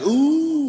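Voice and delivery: high, loud mock crowd "ooh"; imitated crowd ooh. Audience reacts to the comedian's comeback, imitated crowd ooh. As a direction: ooh like a whole scandalized audience. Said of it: "The crowd's like".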